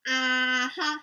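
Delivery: a lightly voiced guard's doubtful hum; sceptical mm-hmm. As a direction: hum it like you don't buy a word.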